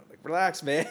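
loud, high plea to relax; calm him down, fed up; exasperated. Retold or imitated. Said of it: "And I'm like".

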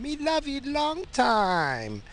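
high, slow, drawn-out sing-song line; mock movie quote. Jokingly quoting the old movie line to listeners. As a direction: stretch it out in a high put-on voice.

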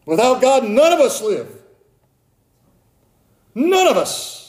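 high, loud, urgent sermon voice; full force preaching. Acted. Pastor urging the congregation to repent, preaching at full force. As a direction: preach it out, pleading and warning.

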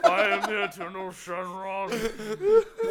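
slow, loud booming dragon voice; Shenron voice. Pretend. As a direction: boom it out slowly like a dragon god.

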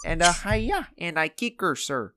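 high-pitched cartoon sidekick with a Russian accent; Bartok. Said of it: "mine sounded like".

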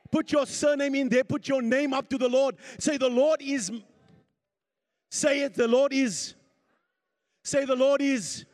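loud, high, rousing preacher; full-force preaching. Real, not acted. Preacher urging congregation to declare the psalm, full-force preaching. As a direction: drive the congregation to claim it aloud.

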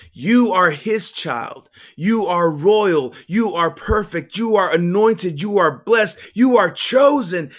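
loud, high, building declarations; climbing, preacher-like affirmation; fervent proclamation. A narrator's reading. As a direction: proclaim each blessing with rising force. Building proclamation over the child.

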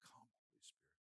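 barely audible, breathed prayer; hushed, intimate plea to God; whispered prayer. Real, not acted. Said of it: "Pray it with me".

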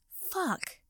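a sudden high yelp of pain; pained cry. Acted. Draco's hair pulled by Potter, high pained cry. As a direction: cry out as your hair is yanked. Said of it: "Draco cried".

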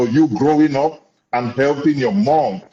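usual speaking voice

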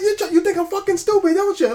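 high, loud mock yell at the cops; hammed-up defiant shout; imitating Sonny yelling. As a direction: hurl it at the police like a cornered robber. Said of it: "He's like".

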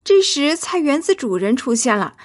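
ordinary delivery, nothing marked

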